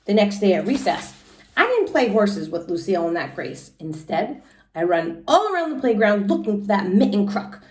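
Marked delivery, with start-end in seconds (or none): none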